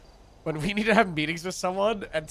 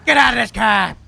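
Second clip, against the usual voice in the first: angry shout; 6 semitones higher, 7 dB louder than usual. Acted. Yelling at a delivery driver who dodged him, angry shout. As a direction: shout it, furious and loud. Roleplay confrontation with delivery man.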